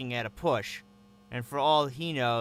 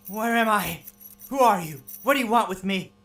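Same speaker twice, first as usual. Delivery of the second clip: frightened demands; 8 semitones higher, 6 dB louder than usual. Acted. Captive wakes cuffed in dark.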